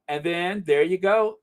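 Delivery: usual speaking voice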